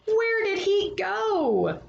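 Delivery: put-on high, slow child's question; a little kid asking where the squirrel went; curious child. A narrator's reading. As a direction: ask it like a wondering kid. Child asks in read story.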